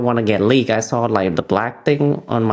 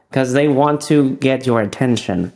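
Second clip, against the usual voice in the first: ordinary delivery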